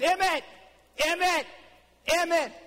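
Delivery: put-on high, loud kid calling a brother's name; a little boy in a tree calls his brother, high and loud.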